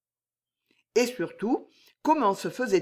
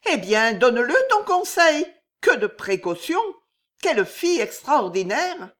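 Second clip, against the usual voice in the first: impatient lady; 6 semitones higher, 6 dB louder than usual. Acted. Jeanne, impatient mistress.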